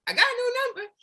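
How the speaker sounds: high, excited voice of the colleague; imitating a colleague. Speaker mimics teammate reporting new numbers, high excited voice. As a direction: mimic her chirpy updates way up high. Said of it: "She's always like".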